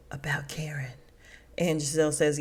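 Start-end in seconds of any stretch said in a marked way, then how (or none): 0.1-0.9 breathy gossiping whisper; hushed aside about Karen. Retold or imitated. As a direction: drop to a secret whisper.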